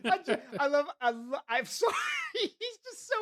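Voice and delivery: ordinary talk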